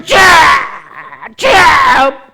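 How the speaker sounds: tearful shouted cries of the Lord's name; crying out Lord. Retold or imitated. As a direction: cry out the name almost weeping. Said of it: "밤새 이러고 있는 거요". (울먹이며 외치는 "주야")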